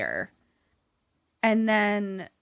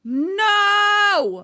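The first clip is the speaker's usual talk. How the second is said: high, loud, long-drawn cry; dismayed shout; 11 semitones higher, 7 dB louder than usual. Real, not acted. Player hearing that Garrett is shot, wailing.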